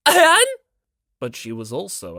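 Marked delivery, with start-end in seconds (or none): 0.1-0.5 high loud squeak of surprise; sudden high loud cry; startled yelp. Acted. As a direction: yelp as she slips. Tsumiki slips.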